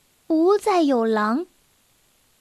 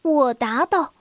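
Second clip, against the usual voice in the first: plain reading voice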